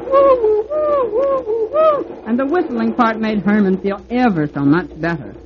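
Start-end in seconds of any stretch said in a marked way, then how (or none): 0.1-2.1 very high hooting woo like a little engine's whistle; train whistle. A narrator's reading. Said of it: "Herman helped along by whistling".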